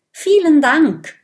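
high, loud thank-you call; calling after. A narrator's reading. Dragon children call thanks after the skunk. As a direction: call it after someone leaving. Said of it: "rufen die Drachenkinder dem Stinktier nach".

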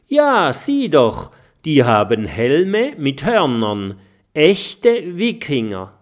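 laut und hoch, ganz aufgeregt. Acted. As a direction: ruf es begeistert hinaus. Mathilda zeigt aufgeregt aufs Schiff. (Mathilda zeigt den Freunden aufgeregt die Wikinger, laut und hoch)

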